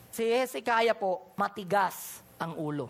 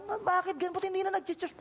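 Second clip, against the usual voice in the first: dismayed; 10 semitones higher than usual. Retold or imitated. Dismayed at friend quitting.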